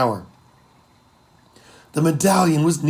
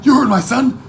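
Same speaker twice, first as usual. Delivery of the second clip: much higher, louder yell to the crew; 11 semitones higher, 8 dB louder than usual. Acted. Mother yells to her crew, loud rallying cry. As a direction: throw it to the whole deck. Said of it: "turned to the crew and yelled".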